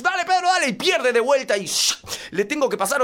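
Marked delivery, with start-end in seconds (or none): 0.0-0.7 high, loud egging-on yell; urgent, high-pitched cheering at a young son. Acted. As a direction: shout encouragement like an over-invested dad. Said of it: "Y lo aliento".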